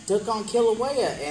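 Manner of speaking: usual speaking voice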